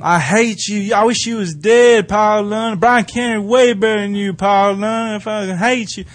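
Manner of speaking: put-on kid going off. Kid screaming insults at wrestler, high mock rant. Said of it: "just going off".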